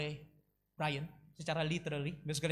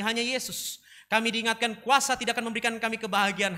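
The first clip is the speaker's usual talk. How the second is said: loud, steady, earnest praying; fervent prayer; 3 semitones higher, 7 dB louder than usual. Real, not acted. Preacher prays for the congregation, fervent and loud. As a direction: pray aloud with mounting intensity.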